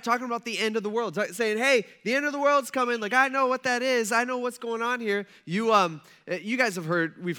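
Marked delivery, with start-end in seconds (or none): 1.6-5.3 high, loud, insistent proclaiming; mock doomsday prophet. False prophet warning of the world's end, mock proclamation. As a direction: imitate an excited end-times announcer.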